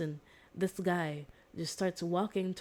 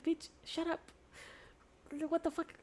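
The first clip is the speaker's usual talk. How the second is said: high, incredulous playful retort; mock shock; 11 semitones higher, 5 dB quieter than usual. Brother snaps back at sister, playful mock shock. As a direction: toss back a fake-shocked jab.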